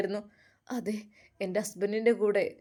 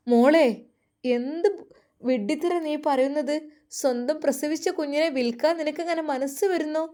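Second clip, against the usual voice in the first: higher, shocked scolding mother; 5 semitones higher than usual. Acted. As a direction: scold her like a dismayed mother, voice raised high. Mother scolds the pregnant girl.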